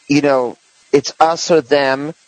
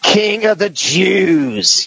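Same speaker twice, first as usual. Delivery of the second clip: slow, raised-pitch imitation of a vehement cry; mock violent proclamation; 4 semitones higher, much slower than usual. Retelling a celebrity's clip to the co-host, mock violent. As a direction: draw it out, higher, as a forceful proclamation. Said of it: "she says that very violently".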